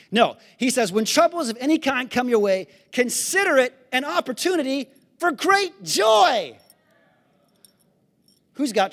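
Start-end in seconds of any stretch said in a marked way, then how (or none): 0.9-6.6 preached scripture reading. Acted.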